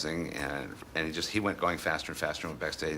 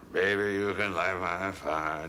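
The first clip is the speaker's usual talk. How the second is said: slow crooning Morrison impression; imitating [name]; 2 semitones higher, 3 dB louder, slower than usual. [name] on stage sounding like [name], slow crooning imitation. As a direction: croon it slowly, the way [name] sang it. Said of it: "sounding very much like [name]".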